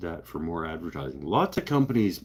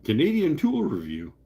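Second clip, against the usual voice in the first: announcer voice; 9 semitones higher, 4 dB louder than usual. Retold or imitated. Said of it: "and be like".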